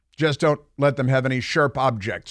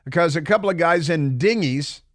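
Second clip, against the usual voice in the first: ordinary talk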